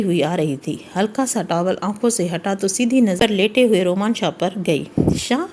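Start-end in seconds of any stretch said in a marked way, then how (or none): 5.2-5.5 startled high-pitched cry of his name; startled, nervous wife; flustered alarm. A narrator's reading. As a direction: caught off guard, panicky and high. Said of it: "Meerab گھبراتی ہوئے".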